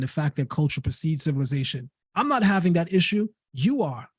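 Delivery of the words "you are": ordinary talk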